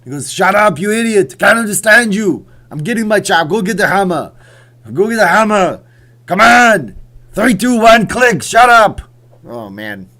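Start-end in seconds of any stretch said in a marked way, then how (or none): 0.3-9.0 high, screamed Austrian action-star impression; furious action hero, building to full scream; Arnold Schwarzenegger. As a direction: yell orders in a hammed-up Austrian accent. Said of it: "I better stop screaming".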